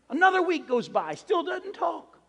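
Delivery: high-pitched, drawn-out storyteller's exasperation; mock exasperation. Storyteller on the parrot's continued silence, mock exasperated. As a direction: stretch it out high for comic despair.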